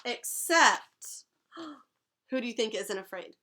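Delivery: usual speaking voice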